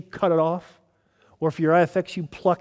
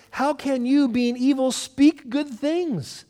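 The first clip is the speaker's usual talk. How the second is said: frustrated rebuke; 8 semitones higher, slower than usual. Retold or imitated. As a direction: challenge them, dragging out the last words. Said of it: "He's frustrated because they're so self-righteous".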